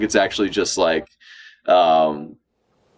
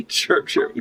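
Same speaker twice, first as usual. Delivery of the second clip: ordinary talk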